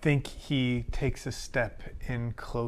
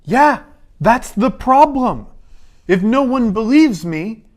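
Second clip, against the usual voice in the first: much higher and louder, frustrated pleading; exasperated outburst; 10 semitones higher, 9 dB louder than usual. Acted. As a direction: throw up your hands, exasperated. Frustrated outburst at stubborn deer.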